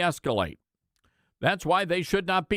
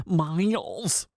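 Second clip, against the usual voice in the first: the name Miles in a drawn-out Michigan accent; 3 semitones higher than usual. Shown as a demonstration. Radio host mocking a congresswoman, Michigan accent. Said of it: "Sorry, that's my Michigan accent".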